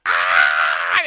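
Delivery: a monster's low death scream; dying roar. Retold or imitated. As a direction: howl it as she explodes. Gertie gets blown up.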